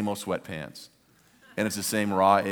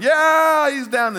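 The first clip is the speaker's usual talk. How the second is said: high, loud, long cheer; jubilant cheer; 10 dB louder, much slower than usual. Retold or imitated. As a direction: cheer like a fan at a knockout. Fan cheers as Apollo falls.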